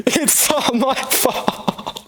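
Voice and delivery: slow, high wail of self-blame; wailing lament. Acted. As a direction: drag it out like a sobbing child. Prince laments again, crying.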